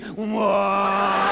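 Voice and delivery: long, drawn whoa held out to annoy; mean whoa. Retold or imitated. The mean version for Birmingham drivers, a long spiteful whoa. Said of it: "if you want to be really mean".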